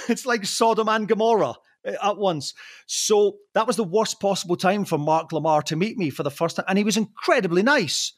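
ordinary delivery

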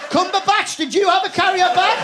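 high, loud comic challenge; calling out. Acted. Winner calling on another nominee as witness, loud mock interrogation. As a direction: holler the name, then the question.

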